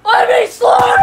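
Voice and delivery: loud excited yell; shouting. Acted. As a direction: yell it out with glee. Kids yelling on playground.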